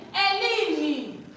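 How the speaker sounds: Helen loud and high, drawn out; Helen demo. Shown as a demonstration. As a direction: model the name Helen, loud and clear. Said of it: "Don't say Helen".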